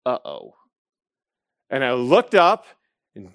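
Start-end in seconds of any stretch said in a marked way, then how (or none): 0.0-0.6 low, soft mock dread; a quiet retold inner uh-oh; sinking uh-oh. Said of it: "everything in me went".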